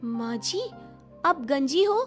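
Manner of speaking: astonished daughter-in-law's question; stunned surprise. Acted. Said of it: "Anjali हैरान रह जाती है".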